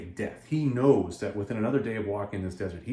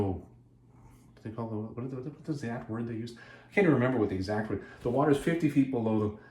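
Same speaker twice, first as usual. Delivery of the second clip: normal talking voice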